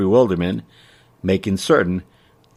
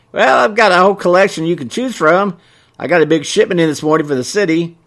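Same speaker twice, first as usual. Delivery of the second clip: high, loud folksy storekeeper voice; eager shopkeeper; 8 semitones higher, 5 dB louder than usual. A narrator's reading. Shopkeeper shows off his shipment to customer, eager. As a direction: pitch the wares up high.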